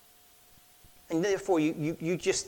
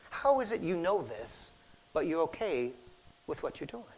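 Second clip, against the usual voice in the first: softer, fading probing question; soft searching question; 2 semitones lower, 7 dB quieter than usual. Shown as a demonstration. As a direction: ask it gently and let it drop away. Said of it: "applied to God's question".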